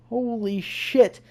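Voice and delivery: slow, soft stunned curse; hushed awe. Real, not acted. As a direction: breathe it out, shaken. Reacting to creepy poem.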